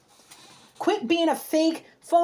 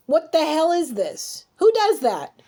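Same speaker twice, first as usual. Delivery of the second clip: drawn-out question, then louder outburst; exasperated disbelief; 4 semitones higher, 3 dB louder, slower than usual. Real, not acted. Reader confronting someone only putting on a front, exasperated disbelief. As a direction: demand an answer, aggravated and incredulous. Aggravated, confronting the person read.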